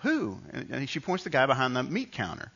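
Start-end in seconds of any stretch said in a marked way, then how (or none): 0.0-0.4 high, lightly voiced child; little kid. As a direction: imitate a kid's puzzled question, pitched high. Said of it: "I was just a little kid".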